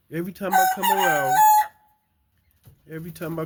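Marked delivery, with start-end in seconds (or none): 0.1-1.4 high, fast, louder playful voice; high, fast playful chatter to the chickens. Real, not acted. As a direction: tease the hens in a high voice.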